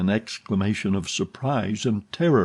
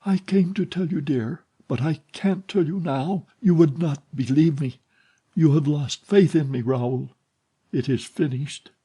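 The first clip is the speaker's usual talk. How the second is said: higher, hushed despair; 6 semitones higher than usual. A narrator's reading. Heroine telling her lover all is lost, despairing. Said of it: "She spoke in such a despairing voice".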